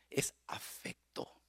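soft slow whisper. Real, not acted. Preacher whispering the answer to the congregation. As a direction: breathe the word. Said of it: "whispered key word".

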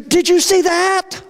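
high, slow, wide-eyed amazement; high excited voice, drawn out; excited Jesus. Acted. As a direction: point it out to them, thrilled. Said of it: "Jesus all of a sudden gets excited. He gets animated".

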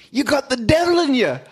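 high-pitched hammed-up accusation; acted accusing wife. Wife accusing the revived man, high and hammed-up. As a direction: accuse him in a high mock voice.

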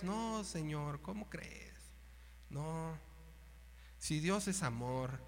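soft, slow mock of Peter; mock pious protest. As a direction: play it as mock piety. (protesta piadosa en burla, suave)

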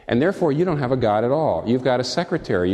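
normal talking voice

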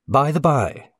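high, loud, firm opener; peculiar firmness. A narrator's reading. Hungarian addresses the whole company firmly. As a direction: plant the words firmly. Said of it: "with a peculiar firmness of accent".

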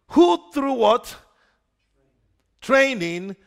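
Training set apart after a pause, leaned on hard; stressed word. Shown as a demonstration. Preacher drills the key word into his congregation, emphatic. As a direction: hit Training like it is written in capitals. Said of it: "I want to stress the word there in capitals".

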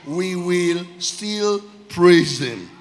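very slow and loud, words set apart; slow declaration. Real, not acted. Preacher summing up intentional praise, slow weighty declaration. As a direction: hold each word for weight. Slow repeated title phrase.

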